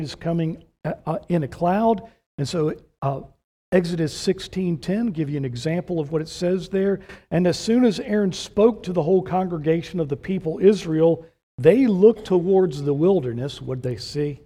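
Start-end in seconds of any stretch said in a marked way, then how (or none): 3.7-13.6 louder, higher pulpit reading; scripture read with rising force; forceful scripture reading. Acted. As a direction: proclaim the verse to the hall.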